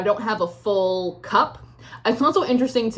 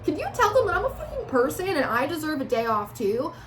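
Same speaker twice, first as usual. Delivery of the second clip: higher-pitched, exasperated appeal; pleading protest; 6 semitones higher than usual. Acted. Querent asking the reader to tell them, high exasperated plea. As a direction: plead your case for a little rest.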